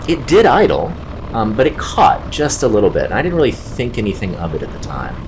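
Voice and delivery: normal talking voice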